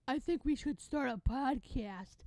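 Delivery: slow mocking impression; mocking copycat. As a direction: drag it out like someone with no ideas. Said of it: "it's so annoying when people are like".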